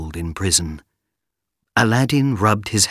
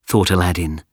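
plain reading voice

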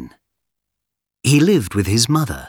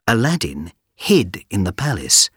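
ordinary delivery

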